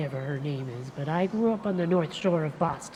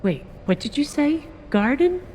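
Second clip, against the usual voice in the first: sharply higher, louder double take; surprised; 8 semitones higher, 5 dB louder than usual. Acted. Detective catching the suspect's slip, sharply surprised. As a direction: pounce on the slip, startled.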